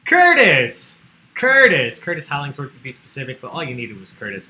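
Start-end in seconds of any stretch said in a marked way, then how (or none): 0.1-2.0 shouted high and loud, triumphant; excited announcement. Real, not acted. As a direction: proclaim the winning name with a big shout. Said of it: "the correct answer here is".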